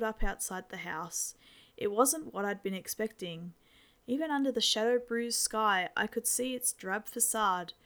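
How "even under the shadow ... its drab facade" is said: usual speaking voice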